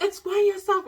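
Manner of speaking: very high, loud furious command; yelling at her fiancé over the phone; ballistic demand. Retold or imitated. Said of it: "and I'm ballistic".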